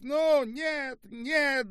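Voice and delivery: very high, drawn cry; frantic protest. A narrator's reading. Olga struggles in the officers' hands, frantic cry. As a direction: cry out while struggling to get free.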